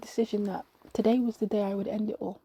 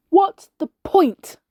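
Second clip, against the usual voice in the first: high, very loud and drawn out; exasperated yell, each word stretched; annoyed shout; 8 semitones higher, 12 dB louder, much slower than usual. A narrator's reading. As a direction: shout it in despair. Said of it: "I got annoyed at that voice".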